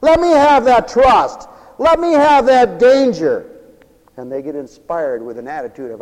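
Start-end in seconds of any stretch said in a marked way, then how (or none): 0.0-3.5 loud, high, slow impassioned cry; fervent plea. Retold or imitated. Volunteers begging for the dangerous mission, fervently loud. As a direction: beg for the hard task with fire. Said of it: "And say".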